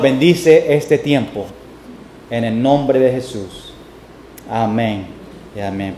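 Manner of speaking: low, soft closing prayer; hushed pulpit prayer. Real, not acted. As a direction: pray quietly and low, with pauses between phrases. Closes an opening prayer.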